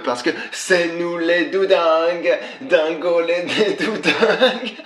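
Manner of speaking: high-pitched cackling through the words; laughing uncontrollably, voice squeaking high; hysterical laughing. Acted. Hysterical prank-call laughter.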